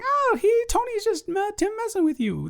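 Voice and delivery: ordinary delivery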